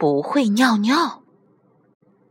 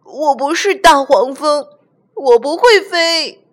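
raised, louder frightened protest; panicked cry; 7 semitones higher, 4 dB louder than usual. Acted. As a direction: cry out from too high up. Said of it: "小憨大叫起来". (害怕得大叫起来)

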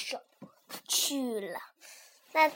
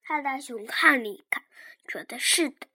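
ordinary delivery

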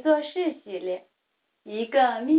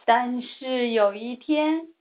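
plain reading voice

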